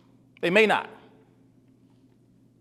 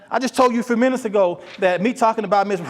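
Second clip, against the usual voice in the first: ordinary delivery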